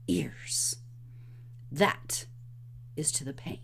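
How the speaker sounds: soft, low whispered threat; menacing whisper. Retold or imitated. Wesley finishing his threat to Humperdinck, low menacing whisper. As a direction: breathe the last words as a chilling threat.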